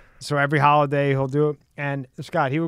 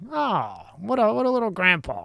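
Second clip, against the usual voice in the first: a hint of gushing over a little grandpa; fond cooing; 6 semitones higher than usual. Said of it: "you're like".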